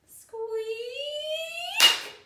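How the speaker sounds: high, long rising squeak; squeaky door. Acted. Storyteller voices the door closing, high drawn-out squeak. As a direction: creak upward like an old hinge.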